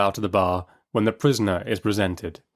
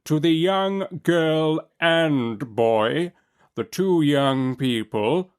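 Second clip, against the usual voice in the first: very slow, deliberate judge; extra distinctness; 6 semitones higher, much slower than usual. A narrator's reading. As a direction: lay each word down pointedly. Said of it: "with extra distinctness".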